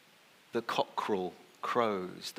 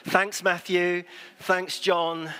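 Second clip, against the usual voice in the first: loud, higher sarcastic thank-you; mock thanks; 8 semitones higher, 6 dB louder, slower than usual. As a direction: thank them with a wry grin. Mock thanks to gospel writers.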